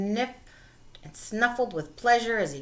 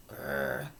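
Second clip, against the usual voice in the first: bear growl; 5 dB quieter than usual. A narrator's reading.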